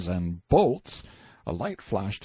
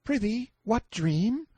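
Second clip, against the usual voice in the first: put-on very high, slow boy's voice; light-hearted high-pitched questioning; teasing boy Clarence; 13 semitones higher than usual. A narrator's reading. Boy makes fun of the prisoner's plight, teasing.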